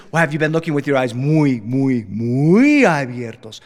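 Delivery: coaxing dad stretching the words. Retold or imitated. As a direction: lean on each muy. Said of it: "He says".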